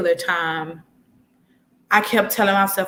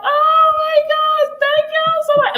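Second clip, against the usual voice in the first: tearful thanks; 7 dB louder than usual. Retold or imitated. Overwhelmed thanks while crying.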